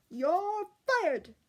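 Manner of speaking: cook bellows it high and loud; angry shout. A narrator's reading. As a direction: yell it at the kitchen maid. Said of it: "shouted the fourth assistant senior cook".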